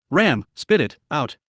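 slow, quavering and sorrowful; trembling voice. Acted. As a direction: let the voice tremble with grief. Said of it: "Her voice was trembling".

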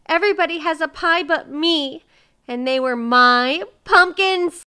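loud, high wail of grievance; weeping. A narrator's reading. Miser protests he alone has no pie, weeping. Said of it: "wept Mister Miser".

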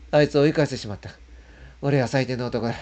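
anguished self-reproach. Retold or imitated. Blaming himself in anguish.

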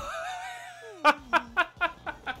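high-pitched disbelieving laugh; high giggle. Real, not acted. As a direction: laugh at your own failure. Laughing at own failure.

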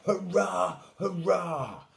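high, loud hurrahs; proud cheering. A narrator's reading. Siblings celebrating Little Lion, proud loud cheers. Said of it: "shouted proudly".

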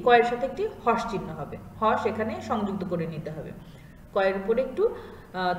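ordinary talk, nothing marked

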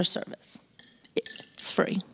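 breathy whispered "It's free"; hushed confiding whisper; whispered aside. Real, not acted. Aside to congregation about free papers.

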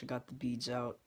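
ordinary talk

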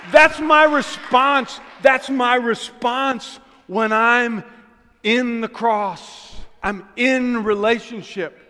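drawn-out, loud emphatic words; slow it right down and lean on each word; slow emphasis. Real, not acted. Preacher driving home his point, slow and loud.

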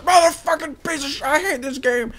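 high-pitched, furious rage scream imitating a meltdown; gamer rage. Gamer acting out his rage at Dom or Ground War, high raging scream. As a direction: scream it in an over-the-top fit of rage. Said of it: "you know I rage".

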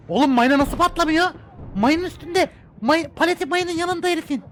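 loud, high disbelief; shocked yelling at the game; surprised. Real, not acted. Tank on mine doesn't explode.

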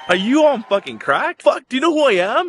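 high-pitched, incredulous tirade; indignant rant. Retold or imitated. Student telling off the school that refused him, indignant rant.

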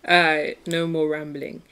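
ordinary talk

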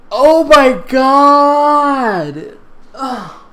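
very slow, high, loud drawn-out wail; exasperated wail. Real, not acted. Reader wailing at the awful writing. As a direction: stretch it out in disbelief.

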